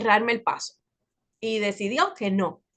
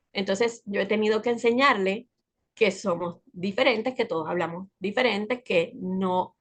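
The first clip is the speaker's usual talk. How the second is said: normal talking voice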